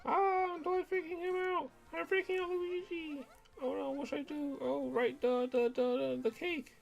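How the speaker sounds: very high, breathless starstruck fan; flustered fangirl. Acted. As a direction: panic sweetly in front of your hero. Fan NPC flustered giving cake.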